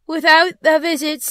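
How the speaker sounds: high, loud spectral warning; stern ghost. Acted. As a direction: pronounce it as a phantom's warning. Ghost warns Scrooge.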